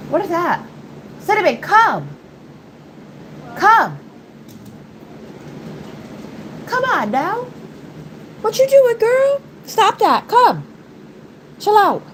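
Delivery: high-pitched dog-scolding voice; calling the dog. Real, not acted. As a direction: call a pet in a high, insistent voice. Calling her dog to come.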